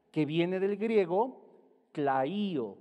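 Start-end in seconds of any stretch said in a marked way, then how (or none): 1.9-2.8 "claío" slow and loud, hard K, stressed í; careful Greek pronunciation. Shown as a demonstration. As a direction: spell out "claío" by ear, stretching the í. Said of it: "con K, L, A, y con acento".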